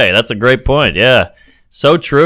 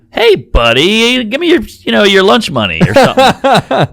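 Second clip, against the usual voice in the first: high, slow taunting bully; mock bully; 7 semitones higher than usual. Comic playing a bully after lunch money, high slow mock taunt. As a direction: draw out a teasing lunch-money demand.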